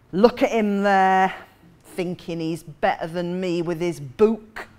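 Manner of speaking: slow, suspicious Geordie jibe; thick Geordie accent. Retold or imitated. Young woman attacking a passenger reading, slow Geordie accusation. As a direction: sneer it slowly in broad Geordie. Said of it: "in a thick Geordie accent".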